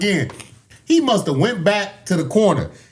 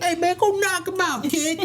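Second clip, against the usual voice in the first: high, softer folksy send-off; good-old coach impression; 11 semitones higher, 5 dB quieter than usual. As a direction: imitate an old hand cheering on the kid. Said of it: "like, good old".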